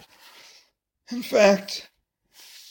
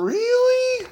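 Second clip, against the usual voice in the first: a very high, squeaky "really?"; delighted disbelief. Pretend. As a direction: squeak it, pretending to be amazed. Reader pretends surprise.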